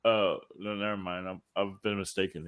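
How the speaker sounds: soft, low mock backpedal; sheepish retraction. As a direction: back down quietly, as if caught out. Acting people backing off on seeing him.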